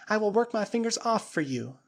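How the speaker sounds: put-on high, earnest plea of a grateful girl; pleading young woman. Flora kneeling before the ape-man, high pleading voice.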